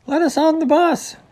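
very high, loud character voice; high-pitched clamouring; impatient demand. A narrator's reading. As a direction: call out shrilly from the queue. Impatient rider demanding to board.

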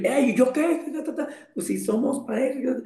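high, laughing mock protest from a partner; mock jealous partner. Partner objects to being told you are free, mock protest. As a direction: play the clingy partner, laughing.

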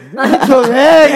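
"goreng" said as "jole", laughing; lisping with a laugh. Retold or imitated. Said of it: "Pelate jiwit".

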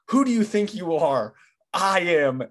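half-shouted bowler's boast, imitating; Pete Weber's taunt. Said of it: "he says this".

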